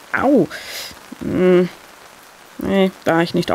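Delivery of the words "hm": plain reading voice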